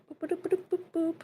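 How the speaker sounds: high, soft little boops; playful booping. Real, not acted. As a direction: boop idly under your breath while clicking. Idle noises while clicking windows.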